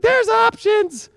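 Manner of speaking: drawn-out high teasing voice; slow, high mimicry of the stars tempting you; mock voice of the planets. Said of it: "Mercury and Sag ... is like".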